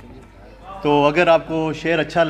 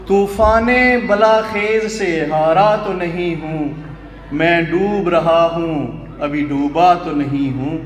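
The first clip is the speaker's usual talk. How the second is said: slow, raised declamation with drawn-out vowels; ghazal recital; 3 semitones higher, 3 dB louder, slower than usual. Acted. Poet presenting his ghazal couplet to the mushaira, slow declamation. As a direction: lift the opening of each line and stretch it out.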